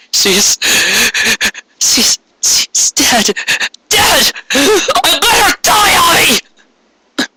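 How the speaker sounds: broken whisper swelling into a high anguished cry; crying, building to a shout. Acted. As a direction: sob it out, then break into a shout of guilt. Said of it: "he started to cry as he talked".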